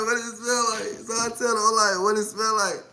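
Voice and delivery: high, soft, giggling; suppressed laughter. Real, not acted. Asking her boyfriend the question, stifling laughter. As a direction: hold back the laugh.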